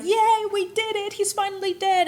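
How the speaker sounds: lightly voiced high celebratory cheer; mock cheer. Conspirators cheering over the body, in a playful high cheer. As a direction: toss it off like a small victory party.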